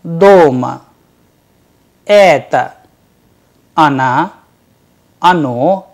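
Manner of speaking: "Mama", "papa", "doma" said cleanly with pauses between; model pronunciation. Shown as a demonstration. Teacher reading a word list aloud for learners to copy. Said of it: "listen to the following words".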